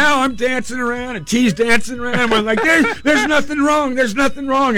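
high-pitched, elated retelling; giddy relief. Retold or imitated. Parents dancing around telling daughter all is well, giddy. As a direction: keep it way up high, overjoyed.

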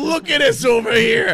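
high shouted mock Philadelphia accent; mock Philly accent. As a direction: join in with an exaggerated Philly yell. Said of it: "if he would have had a wicked Philly accent".

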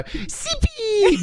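very high squeaky pet name; doggy voice. Retold or imitated. Owner greeting her dog, very high squeal. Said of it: "I go".

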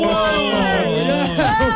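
laughing high yell; surprised shout. Real, not acted. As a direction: whoop in amused shock. Shocked reaction to Boozy's a plug.

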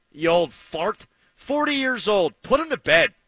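normal talking voice

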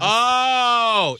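high, loud, drawn-out cry of delight; excited. Real, not acted. Cohost hears celebrity idea for him, excited. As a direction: let out a big thrilled whoop.

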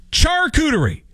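'charcuterie' high, as CHAR-koo-ter-ee; decreed pronunciation. Shown as a demonstration. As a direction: announce 'charcuterie' with hard tch, falling. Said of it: "So that's how we say it".

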